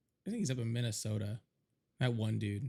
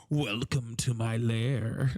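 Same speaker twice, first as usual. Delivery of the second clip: very slow, louder villain greeting; hammed-up vampire; 3 semitones lower, 4 dB louder, much slower than usual. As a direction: drag it out like a vampire in his lair. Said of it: "sounds like I'm fucking Dracula".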